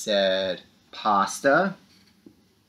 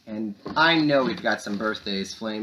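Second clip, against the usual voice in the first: normal talking voice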